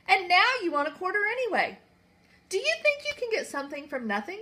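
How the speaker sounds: high, sharp scolding voice; scolding queen. Acted. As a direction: scold him like a cross queen.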